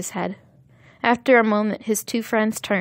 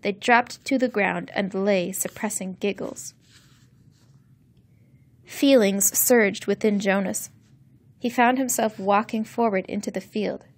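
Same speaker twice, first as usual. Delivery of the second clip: plain reading voice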